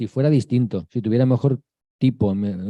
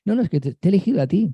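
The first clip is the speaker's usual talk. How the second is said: higher, quick, eager insistence; the Virgin insisting; 8 semitones higher, faster than usual. Retold or imitated. The Virgin Mary answering the reluctant one, eager and insistent. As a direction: insist gently but firmly, as the Virgin Mary. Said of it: "le decía la Virgen".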